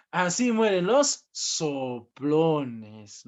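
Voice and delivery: very slow, drawn-out reading of a threat; slow ominous reading. Retold or imitated. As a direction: read the sign slowly, like a grim warning. Teacher reads the death sign aloud. (lectura muy lenta y amenazante)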